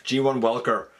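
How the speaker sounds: ordinary talk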